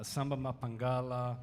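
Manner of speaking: normal talking voice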